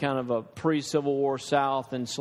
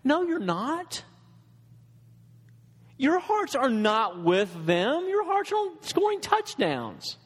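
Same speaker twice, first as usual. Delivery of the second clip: indignant retort; 8 semitones higher, slower than usual. Retold or imitated. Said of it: "And I was like".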